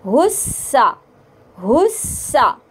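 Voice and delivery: हुस्सा with a clear, held स; doubled s. Shown as a demonstration. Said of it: "हा को सीन के साथ मिलाएंगे".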